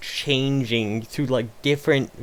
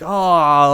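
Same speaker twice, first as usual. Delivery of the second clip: pained wince; 3 semitones higher, 7 dB louder than usual. Retold or imitated. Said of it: "they're just like".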